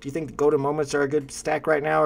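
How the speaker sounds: ordinary talk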